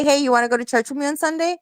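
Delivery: quick, high, casual ask; light, rapid demo of asking plainly; straightforward invitation. Shown as a demonstration. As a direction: toss it off as a quick, easy invite. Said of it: "Mom, just say".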